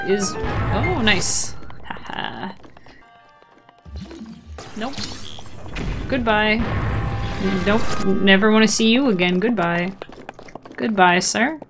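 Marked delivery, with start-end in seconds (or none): none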